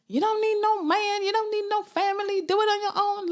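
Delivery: high mock American accent; hammed-up American empowerment preacher. Mocking the single-mum cliché in an American accent. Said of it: "why I put on an American accent".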